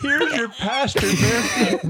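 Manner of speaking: high laughing voice. Real, not acted. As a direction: laugh through the punchline. Laughing at own joke.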